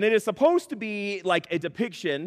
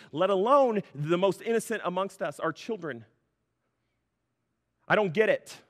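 ordinary talk, nothing marked

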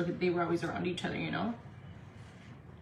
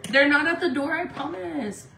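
high, raised voice to a partner; higher-pitched, carrying assurance; calling out; 6 semitones higher, 5 dB louder than usual. Real, not acted. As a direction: call after them insistently. Calls after partner.

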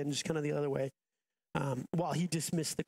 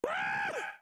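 frightened scream. Acted. Disciples see a ghost.

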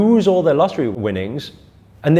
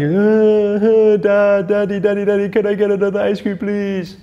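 high, louder, whiny mock sob; crying kid; 7 semitones higher, 4 dB louder than usual. Boy after dropping his ice cream begs his dad, hammed-up crying. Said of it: "he starts crying".

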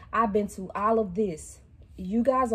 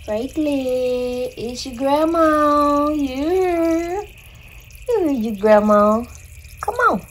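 calling the turtle; 6 semitones higher, 7 dB louder, much slower than usual. Real, not acted. Calling grandson pet turtle.

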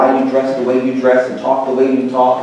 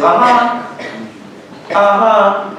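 high, clipped uh-huhs; listen politely on the phone; grandma listening; 9 semitones higher, much slower than usual. Retold or imitated. Grandson imitating grandma on a phone call. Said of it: "the conversation went something like this".

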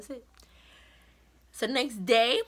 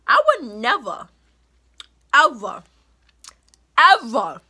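each ever louder than the last, spaced out; emphatic never ever; 9 dB louder than usual. Real, not acted. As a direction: build it up word by word, hammering each ever. Insisting she'd never betray friend.

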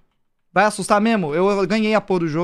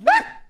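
startled scream. Real, not acted. Susto em jogo de terror.

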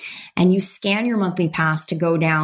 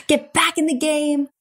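defiant pep talk; 5 semitones higher than usual. Retold or imitated. Herself back then, defying the universe, in a mock-defiant voice. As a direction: throw a laughing dare at the universe.

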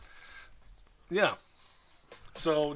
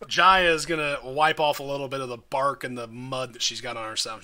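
usual speaking voice

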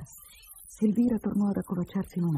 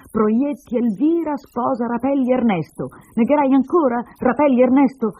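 slow, loud, high declaiming; triumphant proof; 7 semitones higher, 8 dB louder than usual. Acted. Woman brandishes marriage document, accusingly declaiming. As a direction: read out the names as damning evidence.

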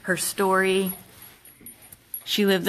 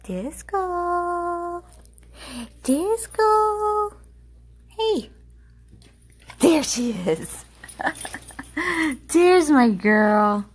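excited dog greeting; 11 semitones higher, 5 dB louder, much slower than usual. Real, not acted. Owner thrilled to see her dog, squealing with delight. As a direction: greet the dog like she's the best thing ever.

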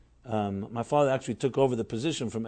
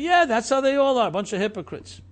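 louder, higher mock scorn; scornful outsider; 10 semitones higher, 7 dB louder than usual. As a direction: sneer like a cynical onlooker. Mocking what outsiders say.

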